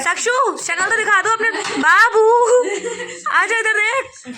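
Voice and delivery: high, loud laughing coax; laughing call. Real, not acted. Calling shy child to camera. (हँसते हुए ऊँची आवाज़ में बुलाना)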